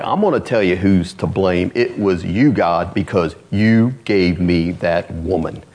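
a hint of a petulant Adam; lightly voiced Adam blaming God. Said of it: "Adam's like".

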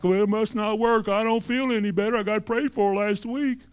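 mock the one who gives up; whiny doubter. Doubter voiced mockingly.

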